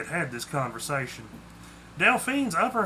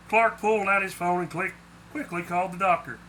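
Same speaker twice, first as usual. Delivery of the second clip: usual speaking voice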